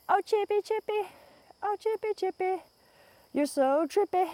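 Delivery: high sing-song coo; calling a chipmunk. Real, not acted. Vlogger calling to chipmunk on trail, high sing-song coo. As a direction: coo up high to the little critter.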